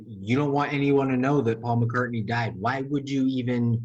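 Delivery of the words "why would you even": ordinary talk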